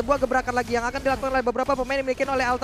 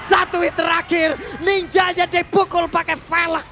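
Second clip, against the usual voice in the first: excited shout; 4 semitones higher, 3 dB louder, much slower than usual. Real, not acted. Caster hypes the final hit.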